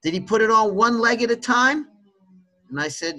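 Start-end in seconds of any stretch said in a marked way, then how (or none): 0.0-1.9 imitating his dad, pitched much higher; playful mock-serious father setting up a joke; a hammed-up higher dad voice; teasing dad. Dad's retorts in a retold phone call.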